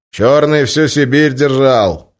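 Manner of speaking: loud, slow and high; loud announcement. Acted. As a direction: proclaim it to the room. Bashka explains to the crowd.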